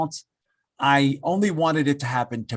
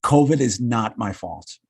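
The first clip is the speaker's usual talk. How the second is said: slow and firm, each word weighted: covid is not my fault; emphatic; 6 semitones lower, slower than usual. Real, not acted. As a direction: declare covid is not my fault slowly and firmly. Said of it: "I just wanna say emphatically".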